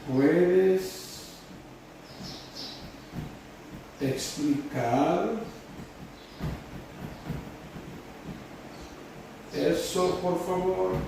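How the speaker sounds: slow, with long pauses between words; spaced-out teacher dictation. Shown as a demonstration. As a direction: hand out the sentence piece by piece. Dictates word by word while writing.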